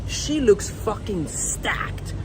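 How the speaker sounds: drawn out as if admiring her; lustful inner thought. Retold or imitated. Quoting his own lustful thought.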